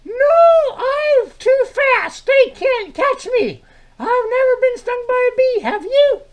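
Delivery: very high, loud, drawn-out character voice; high-pitched puppet sidekick. Acted. [name] answers Milt's greeting in a very high sidekick voice. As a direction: squeak it out slowly and brightly.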